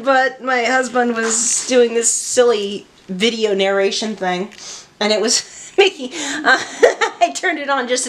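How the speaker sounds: giggling through the words; can barely talk for laughing. Real, not acted. Laughing at husband's silly narration.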